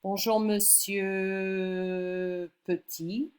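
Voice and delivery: Bonjour, monsieur Petit drawn out slowly in French; slow French greeting. Shown as a demonstration. Teacher modelling a slow French greeting. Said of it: "You might say".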